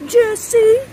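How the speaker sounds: put-on high, loud kid's holler; loud, high-pitched mock call. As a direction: imitate a child yelling for someone, pitch way up. Imitates boy calling for the roommate.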